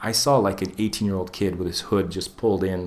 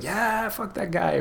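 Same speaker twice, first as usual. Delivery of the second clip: high, slow jeering crowd voice; mocking bystander jeer; mock crowd cheer; 12 semitones higher, 3 dB quieter, slower than usual. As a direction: cheer it like a gleeful onlooker. Crowd cheering as statue breaks.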